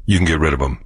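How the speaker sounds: 'em instead of "them"; "'em" said without the th sound; dropped th. Shown as a demonstration. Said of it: "You can dispense with the T H in them".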